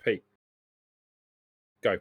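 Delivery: ordinary talk, nothing marked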